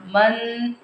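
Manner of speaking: "मर" very slow, ending on a long rolled r; noon turned to ra. Shown as a demonstration. Teacher contrasting sounds for students, held r on "मर". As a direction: roll and hold the r of "मर". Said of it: "फ़र्क भी आवाज़ में".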